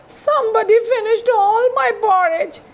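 high, quick little cub voice; tiny little voice. Acted. Baby Bear to his family about his empty bowl, tiny little voice. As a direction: whine it like a small bear cub. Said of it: "in his tiny little voice".

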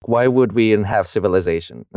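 ordinary talk, nothing marked

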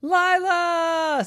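high, loud relieved shout; calling shout. Acted. Daddy finding Lila, loud high call. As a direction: call her name out loud. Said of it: "said Daddy".

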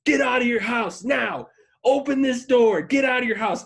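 high, loud shouted orders; mock officer shouting; imitated police screaming. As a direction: yell commands through a closed door. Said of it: "They're screaming at me".

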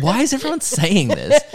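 high-pitched mock exasperation; exasperated protest. Acted exasperated vice principal on prank call.

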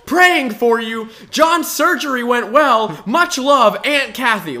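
put-on high, loud, slow old-aunt voice; hammed-up boomer typing in all caps; boomer Facebook comment voice. Reading comments from the boomer group, in a loud, high old-aunt voice. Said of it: "as if they were a baby boomer".

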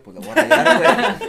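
loud, high laughing outburst; laughing shout. Real, not acted. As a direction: laugh it out defiantly.

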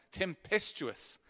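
"Tempestuous" drawn out slowly, savored syllable by syllable; slow, relishing "Tempestuous"; savoring the word. Shown as a demonstration. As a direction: stretch out "Tempestuous" to relish how nasty it sounds. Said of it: "I love that word in the Bible, just sounds nasty".

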